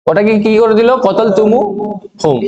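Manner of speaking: ordinary talk, nothing marked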